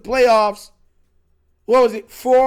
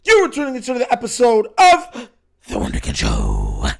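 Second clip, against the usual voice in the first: show host intro; 6 semitones higher, 4 dB louder than usual. Acted. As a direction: call out the show's welcome.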